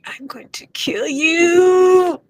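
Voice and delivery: slow, high mock threat. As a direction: drag out a pained fake threat. Reacting to smack on burn.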